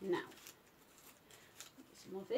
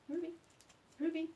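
normal talking voice

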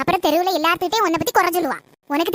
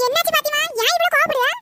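startled question; 8 semitones higher than usual. Acted. Grandma to angry Fathima, startled and high. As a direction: ask in sudden alarm why she is so angry.

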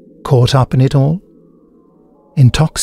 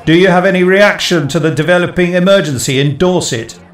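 raised, higher call from a reporter; shout from a crowd; 6 semitones higher than usual. Acted. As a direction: ring it out over the crowd. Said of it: "another voice rang out".

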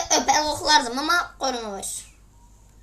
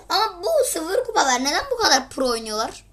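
normal talking voice

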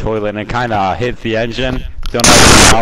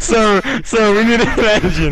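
giggly high urgent plea; laughing plea; 9 semitones higher than usual. Pretend. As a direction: plead through giggles. Laughing pilot pleads with tower.

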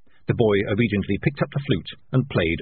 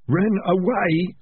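slow, high hoarse answer; hoarsely; 11 semitones higher, much slower than usual. A narrator's reading. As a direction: force the words out hoarsely, drawn out. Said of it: "said the highwayman hoarsely".

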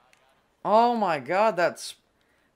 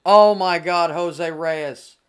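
excited; 9 dB louder, slower than usual. Real, not acted. Home run celebration in game.

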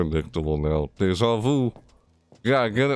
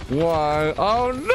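breathless fright; 10 semitones higher, much slower than usual. Real, not acted. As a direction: yelp it out in fright. Caught by monkey in maze.

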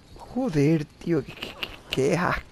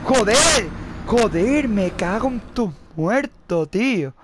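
yelling joder louder and higher; furious shouting; 5 semitones higher, 4 dB louder than usual. Real, not acted. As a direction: explode in frustrated rage. Raging at game, repeated swearing. (gritando furioso)

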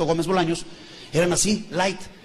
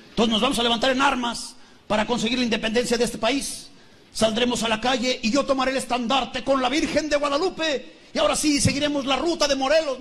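Miguel's rousing speech; 5 semitones higher than usual. Acted. Miguel addressing the crowd for independence, grand declamation. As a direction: proclaim an uprising to the crowd.

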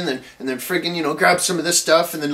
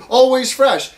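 bright, higher, louder mock ad read of "Always fresh."; mocking a sign slogan; 8 semitones higher, 5 dB louder, slower than usual. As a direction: sing out "Always fresh." like a cheesy slogan, with a fall on "Always". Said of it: "And, and the big sign".

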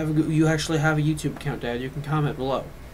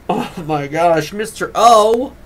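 excited shout; 4 semitones higher, 9 dB louder, slower than usual. Retold or imitated. Excitedly calling the teacher's name.

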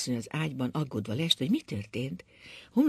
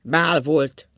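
loud repeat; 8 dB louder than usual. A narrator's reading. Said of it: "ismételte Ákos".

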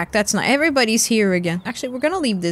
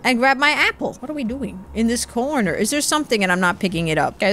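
normal talking voice

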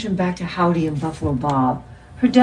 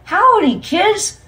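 much higher, loud puppet voice; Howdy Doody greeting; 7 dB louder, slower than usual. A narrator's reading. TV puppet greeting the kids, high and loud. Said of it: "Howdy was back, saying".

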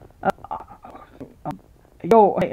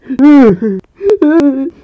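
sobbing noise; 2 semitones higher, 5 dB louder than usual. Acted. Crying sound after bullying.

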